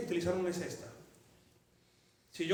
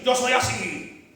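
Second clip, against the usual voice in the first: slow, drawn-out defiant shrug; stubborn husband; 7 dB louder, much slower than usual. Acted. Husband brushing off his wife, slow defiant. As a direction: drag it out, unbothered.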